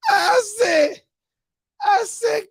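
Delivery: high, cracked from laughing; tearful, high-pitched amusement; crying with laughter. Real, not acted. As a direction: squeeze the words out after a laughing fit. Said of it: "I'm crying".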